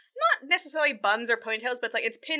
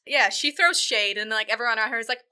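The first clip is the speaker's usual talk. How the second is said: ordinary talk, nothing marked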